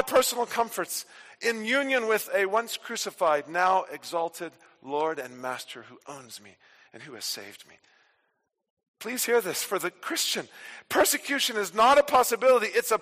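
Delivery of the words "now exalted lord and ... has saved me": ordinary talk, nothing marked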